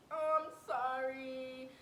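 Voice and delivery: whiny, drawn-out tearful apology; mock crying. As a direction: imitate a sobbing boyfriend, dragging the words out.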